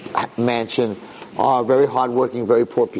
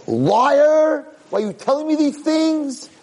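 outraged accusation; slower than usual. Acted. Kid refusing to believe he is prince, outraged accusation. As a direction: hurl the accusation high and upset. Kid rejects old man's claim.

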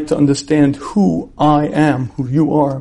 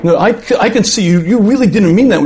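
acted wife, accusing and rising in pitch; mock marital bickering; upset; 5 semitones higher than usual. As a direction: press the accusation, building. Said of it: "she gets upset and have a big fight".

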